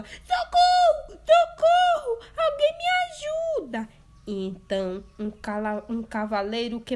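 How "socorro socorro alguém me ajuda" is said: much higher, very loud scream; screaming for help. Acted. Girl chased by the wolf, screaming for help. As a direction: scream in terror. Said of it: "que gritava".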